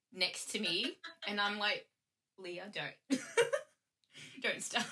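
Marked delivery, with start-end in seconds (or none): 2.4-4.9 laughing through a mild telling-off; laughing scold. Real, not acted. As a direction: scold a friend while laughing. Friend screaming at the wink.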